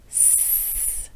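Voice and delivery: hissed S, tongue behind teeth; teacher models a clear S; model S sound. Shown as a demonstration. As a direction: clean long hiss.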